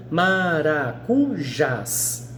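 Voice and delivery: "maracujás" very slowly split into syllables, ending on a clear JÁS; stress on last syllable. Shown as a demonstration. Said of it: "não é a última?". ("maracujás" sílaba por sílaba, bem devagar, destacando o JÁS)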